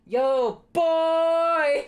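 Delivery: put-on high, loud "boy" as a hype greeting; stretched-out high hype call of "boy"; hype shout. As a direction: yell it out to a friend on stream.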